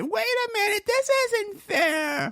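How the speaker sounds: high, slow falsetto mock of an indignant lady; complaining lady. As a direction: whine it out like a cheated passenger. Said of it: "she said".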